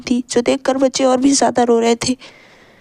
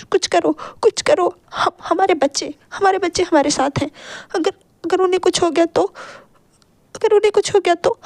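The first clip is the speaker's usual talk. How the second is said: higher, stammering tearful plea; near tears; 5 semitones higher than usual. A narrator's reading. Wife begging her husband to stop the car, near tears. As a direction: beg him through tears. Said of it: "लगभग रोते हुए कहती हैं".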